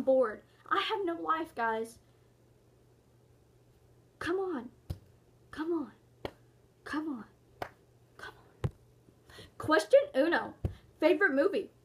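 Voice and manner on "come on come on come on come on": each come on softer, fading to a whisper. Acted. Bored youtuber begging, fading to whisper. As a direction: let it die away each time.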